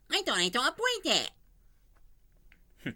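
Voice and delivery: very high, slow cartoon bird voice; Tweety. Showing off his Tweety to listeners, high slow bird voice. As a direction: squeak it out like a tiny yellow bird. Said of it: "while I'm doing Tweety".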